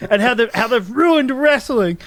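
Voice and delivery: high, melodramatic imitation of anguished wrestler; hammed-up tearful rant; getting upset. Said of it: "he's, like, getting upset".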